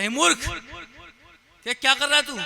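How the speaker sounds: loud, high-pitched rebuke as a crow; scolding crow. Acted. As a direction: cry out indignantly at a fool. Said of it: "कौवे ने शेर को बोला".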